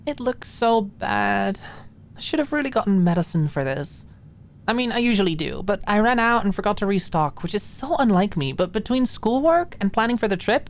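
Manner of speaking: put-on a tired young woman griping about travel; Maud, weary. Maud's voice in the story.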